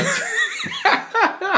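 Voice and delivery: shrieking laugh; high burst of laughter. Real, not acted. Laughing at the chat going downhill, high shrieking laugh. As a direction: let out a high helpless laugh. Laughs after openly fucked.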